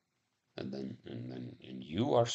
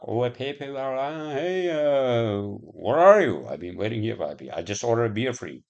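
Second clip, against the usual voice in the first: mock future caller; 4 semitones higher, 7 dB louder than usual. Chipped human calling a friend from the bar, mock loud hailing. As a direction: call out "hey" like a hammed-up chat over a wrist chip. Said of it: "that's how they talk".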